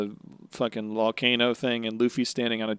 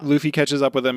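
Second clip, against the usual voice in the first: ordinary delivery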